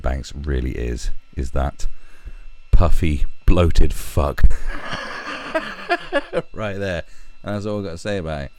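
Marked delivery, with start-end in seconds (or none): 2.7-4.4 spat out loudly; loud, contemptuous jeer; loud insult. Real, not acted. 4.5-6.5 loud, high mocking laugh; high, loud jeering laugh. Real, not acted. Laughing at photo.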